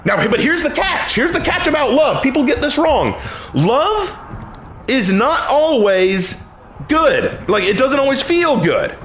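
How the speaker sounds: high-pitched sermon with weighty pauses; emphatic preaching. Real, not acted. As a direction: preach the catch, landing key words.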